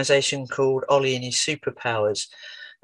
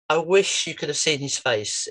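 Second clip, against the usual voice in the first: usual speaking voice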